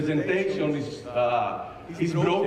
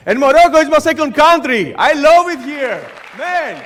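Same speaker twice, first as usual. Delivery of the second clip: loud, higher and gushing; excited praise; 7 semitones higher, 17 dB louder than usual. Real, not acted. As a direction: beam out your love for the country. Praising the host country on stage.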